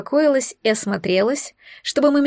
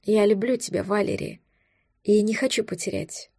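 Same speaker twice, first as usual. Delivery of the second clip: tender dragon, guilty; 5 dB quieter than usual. A narrator's reading. Dragon softly confesses his love to Valeria. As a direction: confess it quietly, sincere and sorry.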